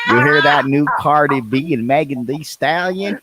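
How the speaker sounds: high hooting hillbilly whoop; excited Southern accent. Pretend. Race fan hyping new music to Earl, hooting Southern drawl. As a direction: whoop like a converted country fan.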